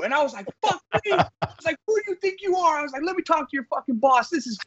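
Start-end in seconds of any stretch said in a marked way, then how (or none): 0.6-1.1 very high incredulous mock yell; hammed-up disbelief, pitched way up; mock outrage. Answering the caller's curse, hammed-up outrage. Retold phone fight. 1.9-2.8 very high hammed-up indignant voice; mock indignation. Yelling at the caller on the phone, mock indignant. 3.1-4.6 high hammed-up demand for the boss; mock insane rant. Demanding her boss on the phone, mock crazy rant. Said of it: "I turned into an insane, crazy person".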